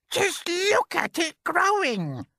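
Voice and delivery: Aunt Spiker shouting. Acted. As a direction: screech it out as the nasty aunt. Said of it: "Aunt Spiker cried".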